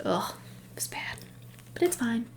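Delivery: whispered groan, then resigned; defeated whisper. Real, not acted. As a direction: sigh it out under your breath. Recovering after retelling the fight.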